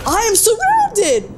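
high, drawn-out panicked cry. Real, not acted. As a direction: wail it high and slow in real alarm. Swarmed by enemies in game.

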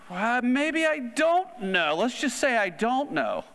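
high, slow, hesitant mock reply; unsure student. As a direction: drag it out like a student stalling. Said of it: "somebody to say".

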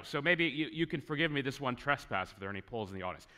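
ordinary talk, nothing marked

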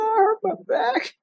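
high, pleading, close to crying; near tears. Retold or imitated. Injured cadet explaining to her supervisor, near tears. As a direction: replay her younger self on the verge of tears. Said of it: "And I almost started crying".

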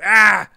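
high, loud "Ah!"; sudden wild scream; crazy yell. Shown as a demonstration. Streamer showing how hyped he gets, crazy yell. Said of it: "trying to keep it crazy".

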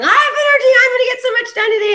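high, fast excited yelling. Retold or imitated. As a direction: yell it bursting with energy. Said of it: "and I'm yelling".

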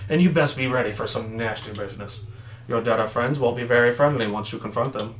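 ordinary talk

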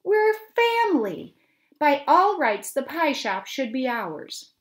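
loud, high protest, slowing at the end; indignant shout. Acted. Mother insisting to the family the shop is theirs, shouting. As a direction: insist it's rightfully theirs. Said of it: "she shouted angrily".

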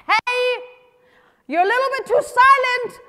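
high, loud complaining holler; calling out to God. Acted. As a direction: shout up at someone too far off.

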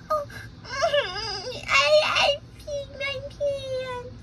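high, slow tearful whimper; high childish cry; crying kid. Acted. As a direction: sob out the confession. Kid admits wetting himself.

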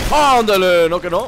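"ándale" fuerte y celebrado; exclámalo fuerte, celebrando el disparo; grito triunfal. Real, not acted. (grito de triunfo)

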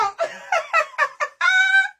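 real high-pitched laugh; high burst of laughter. Real, not acted. Laughing at the unfolding drama.

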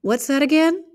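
sharp, high surprised question; father caught off guard, asking sharply; startled query. Acted. Father startled by his daughter, asking high and sharp. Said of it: "Atticus looked pensive".

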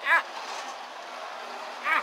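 yelping while whizzing along; short excited shrieks. Real, not acted. Shrieks mid-ride.

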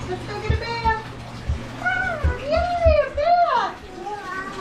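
excited singsong. Acted. Excited bath announcement to child.

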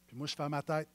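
ordinary talk

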